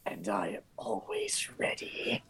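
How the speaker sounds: slow, low whisper; hushed character voice. Acted. Game master voices Carlos's line.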